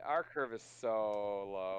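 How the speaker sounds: low drawn-out echo. Acted. Mocking repeat of the line.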